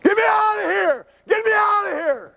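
high, loud, desperate scream for help; panicked trapped man's yell; retold scream. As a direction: scream it like a man trapped in a burning cab. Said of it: "And he starts screaming".

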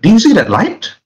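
high, sweeping question; acted high-pitched wonder; wondering girl character. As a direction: urge someone to look up at it. Character points up at light.

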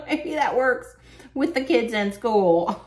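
loud, high laughing delivery; real laughter spilling through slow, high words. Real, not acted. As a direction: crack up at your own joke while talking. Laughing at her own joke.

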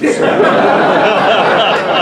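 big high burst of laughter; loud incredulous laugh. Real, not acted. Actor recalling the firing, loud incredulous laugh. Outraged laugh at being fired.

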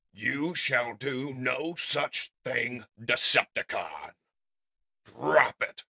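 loud, slow heroic order; commanding hero. Acted. Optimus holds a blaster to Shockwave, commanding. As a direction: order the villain firmly and slowly.

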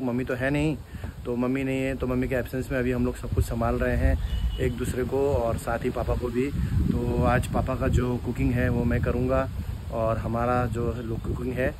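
normal talking voice

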